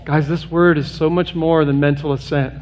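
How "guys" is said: ordinary talk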